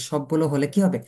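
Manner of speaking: normal talking voice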